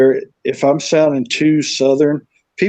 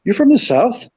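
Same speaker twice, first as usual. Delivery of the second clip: higher-pitched mimicked question; woman asking; 8 semitones higher than usual. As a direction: imitate her guessing, pitched up with rising end. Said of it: "She goes".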